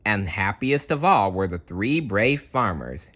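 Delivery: plain reading voice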